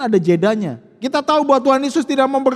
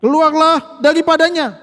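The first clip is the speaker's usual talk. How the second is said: louder, commanding rebuke; stern rebuke; 5 semitones higher, 5 dB louder than usual. A narrator's reading. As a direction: bark the order at the spirit. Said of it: "Yesus menghardik".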